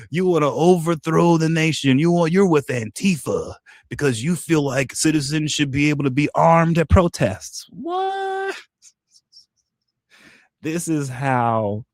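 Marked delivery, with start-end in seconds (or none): 0.0-7.7 high, mocking imitation of critics; mocking opponents. Mimicking critics who call him a traitor, mocking and high. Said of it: "they're like". 7.7-8.6 sudden very high disbelieving squeak; incredulous what. Real, not acted. Reacting to absurd accusations, incredulous squeak.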